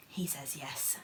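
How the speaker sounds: soft breathy aside, barely voiced; whisper. Real, not acted. Passing on the toy's answer in a whisper. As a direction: lean in and whisper it.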